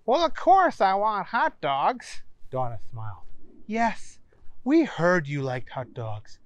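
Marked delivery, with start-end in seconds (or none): 0.0-2.2 put-on high, slow, matter-of-fact boy; of course, said slowly and pitched up; Booger's voice. Booger, obvious request. 3.7-6.4 high, polite hostess voice; sweet and helpful, pitched up; Donna's voice. Acted. Donna explains the treat.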